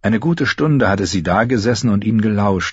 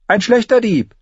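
high, louder dry interjection; dry jab; 4 dB louder than usual. A narrator's reading. Arya cuts in about Lommi, dry. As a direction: cut in dryly. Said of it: "warf Arya ein".

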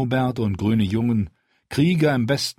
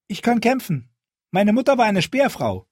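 put-on girl's voice; 13 semitones higher, 3 dB louder than usual. A narrator's reading. The girl steps forward.

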